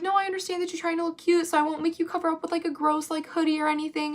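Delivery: high, quick consoling imitation; put-on high reassuring voice; understanding staffer. Imitates staffer soothing upset student.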